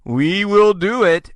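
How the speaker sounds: normal talking voice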